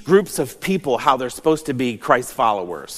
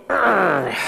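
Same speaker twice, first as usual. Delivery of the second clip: re-enacted high, falling grunt of pain; 4 semitones higher than usual. Man retelling how he hit his thumb hanging a painting, pained grunt. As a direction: imitate grunting after a blow to the thumb. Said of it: "hitting my thumb and going".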